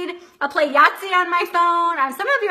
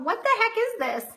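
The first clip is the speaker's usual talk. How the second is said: retold puzzled worry; 8 semitones higher, faster than usual. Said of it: "And I'm going".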